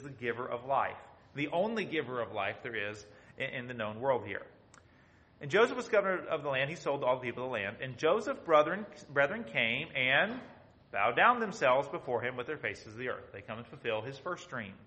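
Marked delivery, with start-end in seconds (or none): 5.4-13.3 loud, declaiming Bible reading; proclaiming King James verses to the congregation; scripture reading. Acted. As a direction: read the verses out like a preacher. Reads scripture aloud.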